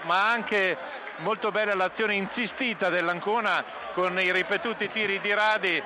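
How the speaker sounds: ordinary talk, nothing marked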